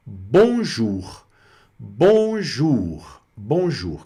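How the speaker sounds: "bonjour" said the French way, nasal on and a "zhoor" ending; French pronunciation demo. Shown as a demonstration. Teacher introduces the first French greeting to learners, French pronunciation demo. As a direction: model "bonjour" carefully, drawing out the second syllable. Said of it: "falar um pouquinho aqui também da pronúncia".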